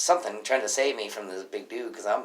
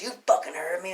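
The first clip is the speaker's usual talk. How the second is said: raised-pitch aggressive comeback; retold confrontational retort; 8 semitones higher, 4 dB louder than usual. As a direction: imitate a customer squaring up. Customer squares up, retold.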